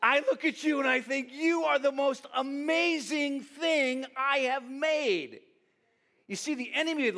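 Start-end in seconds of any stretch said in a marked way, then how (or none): 0.0-5.4 high, loud, drawn-out declaration of delight; emphatic proclamation. Acted. God telling you what he made, emphatic proclamation. Voicing God's delight, repeated.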